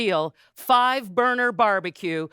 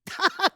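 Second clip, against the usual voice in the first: short laugh; 7 semitones higher than usual. Real, not acted. Laughs at the cooler joke.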